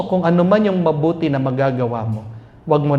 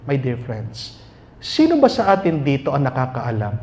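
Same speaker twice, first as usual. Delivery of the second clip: normal talking voice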